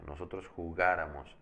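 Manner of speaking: jugáramos with GA as the stressed syllable. Shown as a demonstration. Teacher repeating the accented form, stress on GA in jugáramos. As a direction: lean on the GA of jugáramos. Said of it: "Ese ga va a llevar acento".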